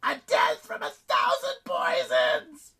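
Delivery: very high, slow, loud shriek; enraged, drawn-out monster screech; screaming goddess. A narrator's reading. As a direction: scream it out, stretching every word. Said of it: "she screamed".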